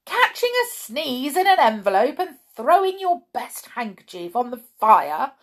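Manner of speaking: high, loud telling-off from a cross wife; wife scolds her husband over the burnt handkerchief; scolding. Acted. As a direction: wag a finger as you scold him. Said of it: "how she scolded Mister Twiddle".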